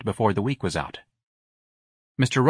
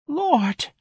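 very high, pained exclamation; overcome. Acted. Woman hearing a sad life story, overcome. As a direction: exclaim as if moved to pity. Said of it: "overcome by old lady Lopez's biography".

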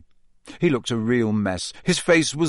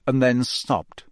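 plain reading voice

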